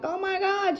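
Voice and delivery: very high, breathless oh my God; dismayed. Retold or imitated. Daughter learning her helper is at work, dismayed. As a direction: let the panic break through. Hearing neighbor is away.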